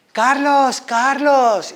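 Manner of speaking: put-on high, loud call of an old lady's name; calling across the shop. Old lady calls her "son" across the shop, loud and high. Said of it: "Y me va la señora desde el fondo".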